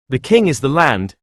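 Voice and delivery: ordinary delivery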